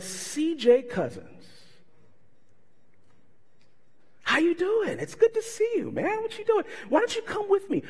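high, bright-eyed hearty welcome; enthusiastic greeting. Retold or imitated. Retelling how the pastor greeted him, high and hearty.

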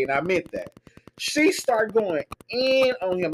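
ordinary talk

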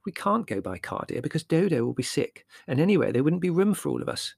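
a hint of a fussing mother; lightly voiced mother.

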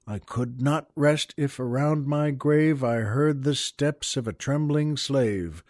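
slow, solemn poem reading; measured, grave reading of the poem; poem recital. Acted. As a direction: read it like a memorial verse. Reads a poem aloud.

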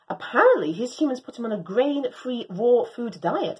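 ordinary delivery, nothing marked

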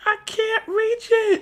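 put-on high, slow kid voice; little girl voice. Girl who can't reach the paper, high slow kid. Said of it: "She was like".

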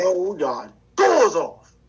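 very slow, drawn-out gloating chant; slow loud taunt. Pretend. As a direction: drag every word out to rub it in. Teasing catchphrase cuts in.

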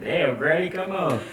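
high-pitched mock complaint; imitated pleading; exasperated protest. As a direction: protest to granny like a wronged kid. Child protesting the slap.

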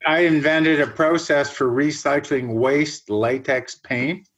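ordinary talk, nothing marked

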